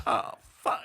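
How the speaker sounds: put-on breathy drunken cursing; voiceless drunk lament; drunk guy. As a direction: mutter it like a drunk who's in trouble. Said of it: "a drunk guy that's like".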